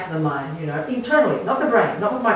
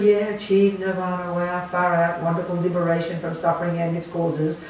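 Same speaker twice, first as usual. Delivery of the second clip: level, droning imitation of dazed awe; mock spaced-out awe; 4 dB quieter than usual. Teacher imitating people who miss the point, mock awestruck drone. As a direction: drone it like a zoned-out believer. Mimics listeners glazing over at lofty words.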